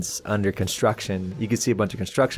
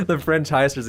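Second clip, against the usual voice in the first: usual speaking voice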